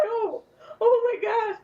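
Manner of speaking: high, laughing oh my gosh; overwhelmed gasp. Retold or imitated. Mother learning her son's name meaning, high and overcome. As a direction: gush it out, overcome with joy.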